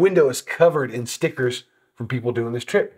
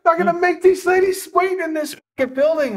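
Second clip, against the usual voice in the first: much higher, a little louder, sarcastic outrage; mock indignant squeak; 4 dB louder than usual. Telling his friend why the ladies go first, in a high mock squeak. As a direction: squeak it out as if it were obvious.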